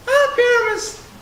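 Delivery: high, loud falsetto lady voice; monstrous little voice. Acted. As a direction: squeak it up as a lady. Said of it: "I'll speak in a monstrous little voice".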